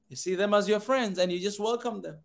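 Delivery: usual speaking voice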